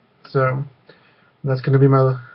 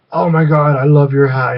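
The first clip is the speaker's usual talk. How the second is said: louder mock gush over a hat; imitated gushing compliment; 2 semitones higher, 8 dB louder than usual. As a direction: imitate a stranger gushing, voice raised. Retelling stranger gushing over hat.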